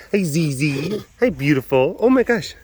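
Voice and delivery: high, excited cooing greeting; gushing pet talk. Real, not acted. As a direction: coo hello to a beloved pet.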